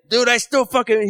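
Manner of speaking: high, drawn-out retold rant; Donnell Rollins. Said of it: "Donnell Rollins literally said that yesterday".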